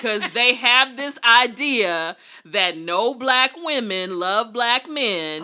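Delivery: loud, slow, drawn-out declaration; big emphatic public announcement, words stretched out; emphatic proclamation. Real, not acted. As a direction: proclaim it high and loud for everyone to hear.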